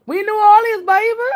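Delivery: high, excited mock New Orleans accent. Streamer recalling arriving down there, imitated New Orleans accent. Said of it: "I want they accent".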